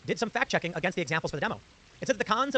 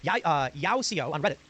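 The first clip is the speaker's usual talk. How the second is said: ordinary delivery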